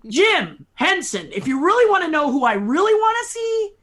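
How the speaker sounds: high, loud eager outburst, slowing on the name; excited. Real, not acted. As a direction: gush to your hero. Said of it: "So I'm very excited to meet you".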